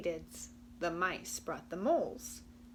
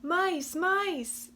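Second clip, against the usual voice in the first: higher, louder call across the meadow; 9 semitones higher, 7 dB louder than usual. Acted. Possum calling the mice at the meadow's edge, loud and high. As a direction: cry out to the mice. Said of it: "Possum cried".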